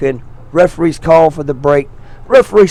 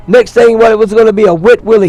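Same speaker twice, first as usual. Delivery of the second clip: excited; 6 semitones higher, 5 dB louder, faster than usual. Real, not acted. Commentator giddy over a wet willy, excited rush. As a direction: voice jumps up, louder and faster.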